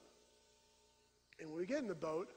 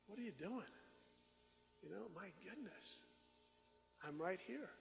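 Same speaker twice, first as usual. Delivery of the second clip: soft, easy reassurance; calm Jesus; 8 dB quieter than usual. Pretend. Jesus reassuring a fearful believer, calm and soft. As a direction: soothe him gently, totally unbothered. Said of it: "He's just like".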